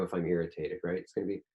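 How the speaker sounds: normal talking voice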